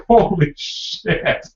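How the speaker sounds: slow, drawn-out holy shit; shocked. Retold or imitated. Mom realizing her mistake, slow shocked holy shit. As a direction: let it sink in slowly. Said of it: "so my mom's like".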